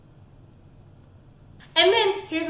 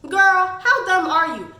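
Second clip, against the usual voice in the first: louder, slow, incredulous put-down; exasperated scold; 4 semitones higher, 6 dB louder than usual. Real, not acted. Reviewer scolding the heroine, loud slow disbelief. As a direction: call her out in disbelief.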